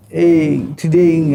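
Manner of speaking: ordinary talk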